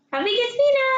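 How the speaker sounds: put-on high and loud, asking the baby; sing-song question to a toddler; high excited baby talk. Shown as a demonstration.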